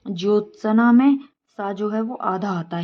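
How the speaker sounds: usual speaking voice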